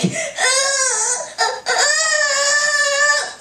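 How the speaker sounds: high, drawn-out fake wail; hammed-up toddler bawling; mock baby crying. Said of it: "they start crying".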